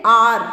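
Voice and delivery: आर said loud and clear as in hour; a loud model आर; pronounce the sound. Shown as a demonstration. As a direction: say आर loud so the class hears the sound. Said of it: "जब हम उच्चारण करेंगे".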